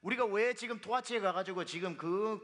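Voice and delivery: ordinary delivery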